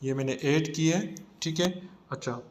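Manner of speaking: normal talking voice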